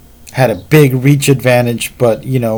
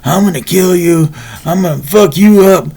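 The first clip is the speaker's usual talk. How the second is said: higher, mock angry threats; a hint of a drunk's angry yell; retold drunk yelling; 7 semitones higher than usual. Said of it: "he's screaming about".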